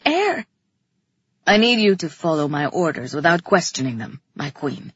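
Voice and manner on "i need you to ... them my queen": low male character voice, controlled; deep-pitched, steady command; Theron's commanding voice. A narrator's reading. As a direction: give a low, firm order, dropping off at the end. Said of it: "he told me".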